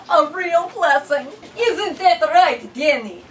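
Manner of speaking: very high, loud exclamation; delighted woman. A narrator's reading. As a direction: squeal it out as a blessing. Mrs Kessler voiced.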